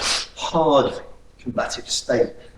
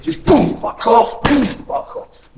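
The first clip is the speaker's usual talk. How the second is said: higher, punchy combat yells; aggressive demo; 7 semitones higher, slower than usual. Shown as a demonstration. Instructor showing a trainee the strike, aggressive mock yells. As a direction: sell it like you mean to end the fight. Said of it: "Don't complicate it".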